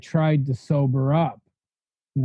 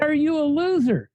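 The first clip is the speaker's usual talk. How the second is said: blunt question; 9 semitones higher than usual. Retold or imitated. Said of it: "saying to me in the most sensitive way".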